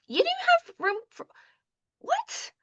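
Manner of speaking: a stunned, high-pitched double take; shocked disbelief. Retold or imitated. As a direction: disbelief that climbs up and up. Shocked at friend's Europe move.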